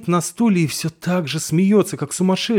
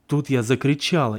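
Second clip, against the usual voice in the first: ordinary delivery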